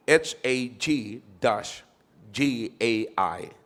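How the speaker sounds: slow letter-by-letter spelling of H A G dash G A I. Shown as a demonstration. Pastor spelling Haggai for the church, slow letters. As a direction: spell each letter slowly, clearly. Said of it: "nakalagay dito".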